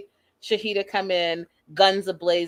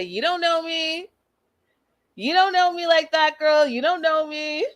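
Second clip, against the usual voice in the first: sassy mock retort; 9 semitones higher than usual. Imitating Shahida telling Shida off, high sassy retort. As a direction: toss it back high and sassy. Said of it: "She goes on to say".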